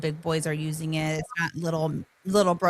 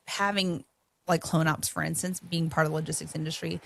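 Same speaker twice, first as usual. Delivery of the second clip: ordinary talk, nothing marked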